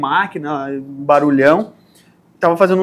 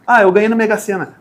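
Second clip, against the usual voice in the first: ordinary talk, nothing marked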